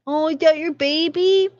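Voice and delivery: high sing-song cooing at the dog. Real, not acted. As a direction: coo up high like to a puppy.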